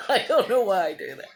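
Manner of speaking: quick words through a laugh; real giggle running into the words. Real, not acted. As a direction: laugh at yourself as you say it. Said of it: "I keep laughing".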